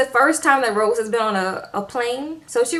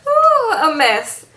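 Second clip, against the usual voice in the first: high, drawn-out "ooh" out of laughter; laughing exclamation at the mess; real amusement; 8 semitones higher, 5 dB louder, much slower than usual. Real, not acted. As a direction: recover from a laugh with a loud, slow hoot. Own reaction after laughing at story.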